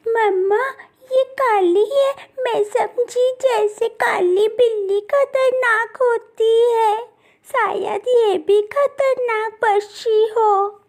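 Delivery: a tiny chick whimpering to her mother; high, fearful baby bird voice; scared little chick. Acted. Frightened chick talks to mother.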